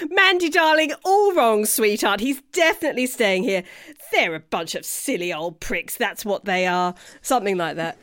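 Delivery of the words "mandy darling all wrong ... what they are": a hint of a British showbiz accent; Jan's accent. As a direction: gush at Mandy like a theatrical publicist. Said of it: "do your best accent on that too".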